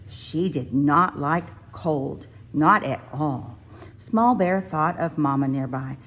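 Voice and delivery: ordinary delivery, nothing marked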